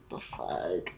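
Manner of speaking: low, quiet disbelief; baffled mutter. Retold or imitated. Student baffled by her big words, muttering. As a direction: drop it low under the breath.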